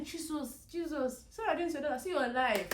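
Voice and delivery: high-pitched calling on Jesus; reenacted cry for help; retold shout. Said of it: "then I shouted".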